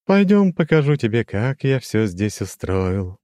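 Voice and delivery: high, slow, chiming demon voice; lofty demon with a glassy ring; crystal ringing voice. A narrator's reading. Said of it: "прозвенел он". (высокий звенящий хрустальный голос демона)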